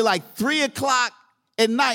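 ordinary talk, nothing marked

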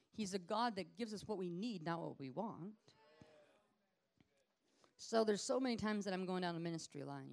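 normal talking voice

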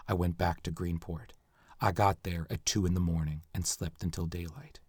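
low, hushed confession read; dramatic reading. Acted. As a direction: read the murderer's confession grimly and softly. Reads a murderer's confession aloud.

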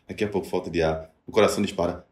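ordinary talk

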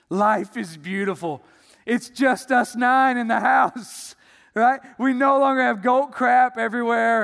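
high, loud and delighted; overjoyed relief. Retold or imitated. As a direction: burst with relief. Man relieved after goat removed.

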